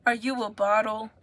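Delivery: usual speaking voice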